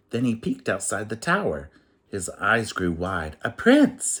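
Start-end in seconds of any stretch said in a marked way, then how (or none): 3.4-4.2 high, loud thrilled cry of a prince; a surprised, delighted cry; excited cry. Acted. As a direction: shout it out, overjoyed. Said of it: "he cried".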